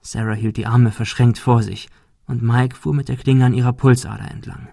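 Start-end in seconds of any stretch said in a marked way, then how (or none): none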